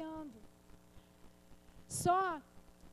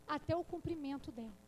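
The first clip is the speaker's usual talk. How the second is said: usual speaking voice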